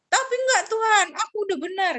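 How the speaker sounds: put-on child refusing blame before God; whiny protest. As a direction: protest like a stubborn kid.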